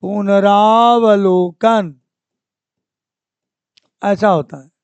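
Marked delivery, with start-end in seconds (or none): 0.0-2.0 पुनरावलोकन said very slowly, syllable by syllable, long आ after र; careful correct pronunciation. Shown as a demonstration. Teacher slowly modelling the right word for students. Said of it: "क्या होना चाहिए था?".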